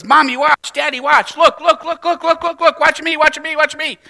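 put-on high, loud child begging "watch me"; a child calling to mommy and daddy, high and eager; eager kid. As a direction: play a little kid desperate for attention. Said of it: "a kid that says".

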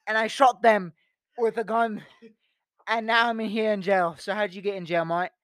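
lightly put-on British accent; mock British storyteller drifting Australian. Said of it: "that changed to Australian".

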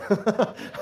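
a high, bright burst of laughter at the punchline. Real, not acted. Preacher laughing at his daughter's reply, a high burst of laughter.

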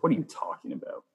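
baffled retort. Retold or imitated. Son arguing with mom in the parking lot, baffled. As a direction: push back in puzzled disbelief. Retold argument with his mother.

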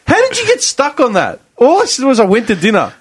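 high-pitched, exasperated protest. Real, not acted. Storyteller to friends derailing his dinner story, exasperated. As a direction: protest at being sidetracked, pitch jumping up.

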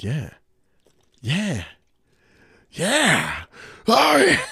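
increasingly worked-up hammed-up reaction, climbing with each repeat; mock arousal escalating into a shrill yell; mock excited watching. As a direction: build from a plain yeah to a high shout. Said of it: "this is me when I'm watching your page".

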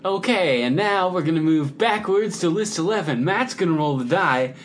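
high, loud parody of a fancy host; mock meeting-leader voice. Player teases co-host for how she runs the game, mock fancy voice. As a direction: exaggerate the co-host's chirpy announcing. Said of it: "I know, but you're like".

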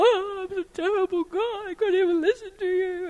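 high, tearful imitation wail; exaggerated weepy self-reproach; mock bawling. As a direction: bawl it out, hamming up the guilt. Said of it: "I'd just bawl".

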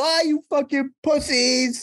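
high, slow mock jeer at the drinkers; mock taunt. Tricking a table with a Radler, high mock taunt. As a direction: jeer at them like a rowdy beer-hall mate. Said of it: "you go".

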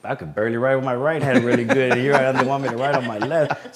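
laughing through the words; amused disbelief, laughing. Retold or imitated. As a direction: chuckle as you say it. Laughing at writing left-handed.

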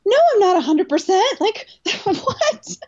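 high, disbelieving, laughing; retold disbelief ending in a laugh; high incredulous no, breaking into a laugh. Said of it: "looking at the guy like".

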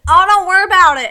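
a loud, high Southern "don't worry"; mimicking a woman's thick Southern accent; mimicked Southern accent. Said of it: "she had a very thick accent".

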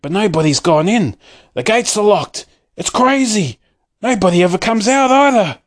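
high, rising bewildered shouts; shouting. A narrator's reading. Townspeople at the locked gates, shouting in bewilderment. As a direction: cry out that it makes no sense. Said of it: "the people shouted".

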